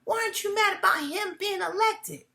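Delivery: high, incredulous mimic of a rider; mock passenger. Rideshare passenger asking the driver, high mock disbelief. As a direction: pitch it up like a puzzled passenger. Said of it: "look at me like".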